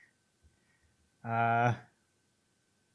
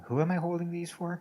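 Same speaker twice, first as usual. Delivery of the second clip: higher-pitched mock puzzlement; imitated puzzled friend; 7 semitones higher than usual. Co-host imagines friend looking at comics, puzzled mock voice. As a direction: put on a baffled questioning voice. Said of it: "He's looking at those Robin comics going".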